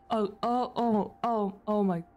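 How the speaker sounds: ordinary talk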